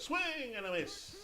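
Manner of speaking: high, drawn-out announcer cry; excited strikeout call. Pretend. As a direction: stretch the first word high as the batter misses. Commentator calls a strikeout.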